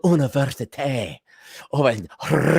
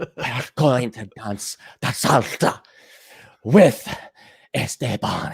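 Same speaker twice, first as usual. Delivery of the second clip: mock Spanish accent; 3 semitones lower, slower than usual. Mocking women faking Spanish.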